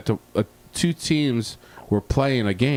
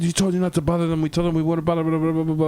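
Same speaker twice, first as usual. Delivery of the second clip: higher, fast mock scolding from the boss; 7 semitones higher, much faster than usual. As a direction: rattle it off like an annoyed boss caught you. Bosses scolding him, retold.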